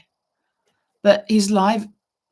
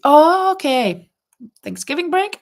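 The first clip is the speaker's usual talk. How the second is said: surprised oh okay; 8 semitones higher, 7 dB louder than usual. Real, not acted. Reading Laurie's reply about the holiday, high and surprised. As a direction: let it dawn on you. Reacting to Laurie's chat message.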